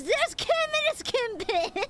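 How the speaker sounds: giggling delight at his own phrase; laughing. Real, not acted. Repeats phrase, then laughs.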